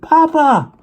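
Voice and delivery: very high, loud "Papa"; a girl spotting her father in the crowd; shouting for father. A narrator's reading. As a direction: call out across the platform. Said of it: "she shouted".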